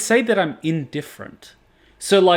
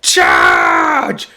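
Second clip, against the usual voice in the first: deep shouted charge command, much lower; retold battle cry; 13 semitones lower, 7 dB louder than usual. Streamer acting out a soldier charging on horseback, deep shout. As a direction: bellow it like a rider charging titans. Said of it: "He's like".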